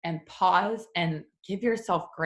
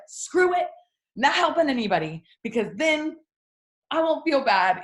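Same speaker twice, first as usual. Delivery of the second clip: louder, higher, defiant shrug; giving up; 7 semitones higher, 4 dB louder than usual. Retold or imitated. Overwhelmed woman shutting down, defiantly giving up. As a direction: toss it all away, higher and louder. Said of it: "I'm just like".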